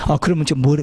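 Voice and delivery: ordinary talk, nothing marked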